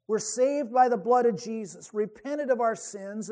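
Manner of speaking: usual speaking voice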